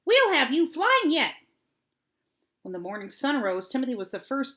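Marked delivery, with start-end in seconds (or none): none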